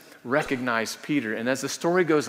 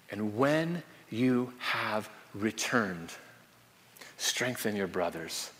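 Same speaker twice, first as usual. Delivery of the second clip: slow and softer, lower at the end; gentle solemn charge; 3 semitones lower, 7 dB quieter, slower than usual. Retold or imitated. Jesus gently charging Simon Peter, slow and solemn. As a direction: lay it on him gently, with weight.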